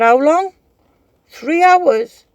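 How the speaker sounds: high, loud urgent questions; loud quoted phone call. Retold or imitated.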